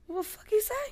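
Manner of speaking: squeaky high shocked question; stunned disbelief. Retold or imitated. Retelling the judge freeing her, squeaky shocked disbelief. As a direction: blurt it out in total disbelief. Stunned reaction in court retold.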